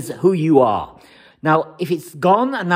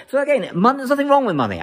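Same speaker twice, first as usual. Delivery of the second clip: "money" stressed in a high-pitched, insistent line; 8 semitones higher, faster than usual. Real, not acted. Coach defending love of money to materialistic people, emphatic on "money". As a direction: lift the pitch and press on "money". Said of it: "I'm emphasizing that word".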